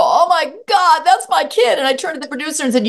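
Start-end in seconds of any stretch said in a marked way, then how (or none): 0.0-1.8 crying dad. Retold or imitated. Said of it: "He starts to cry and go".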